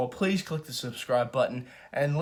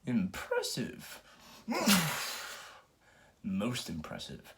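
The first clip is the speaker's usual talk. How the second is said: Vader impressed; 2 semitones lower, 8 dB quieter, slower than usual. Retold or imitated. Vader quoted watching Luke.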